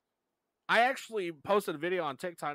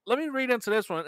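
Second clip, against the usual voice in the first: excited; 6 semitones higher, 6 dB louder than usual. Retold or imitated. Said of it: "I was like".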